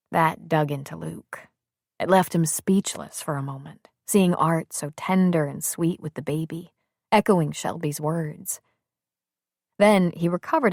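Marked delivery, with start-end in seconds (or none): none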